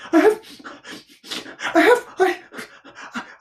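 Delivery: high squeaks broken by panting; winded, panicky piglet stammer; breathless Piglet. A narrator's reading. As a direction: struggle to get the word out, then burst out loud. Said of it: "breathing so hard that he could hardly speak".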